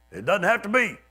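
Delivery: sharp, high mock scolding; acted rebuke. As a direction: imitate a preacher shutting down a question. Acting out pastor's rebuke to his question.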